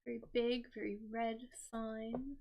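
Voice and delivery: soft repeat. Shown as a demonstration. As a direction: echo it quietly to yourself. Repeating the stop sign clue.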